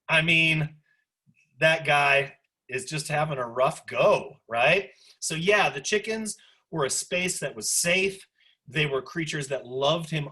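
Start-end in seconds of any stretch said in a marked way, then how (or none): none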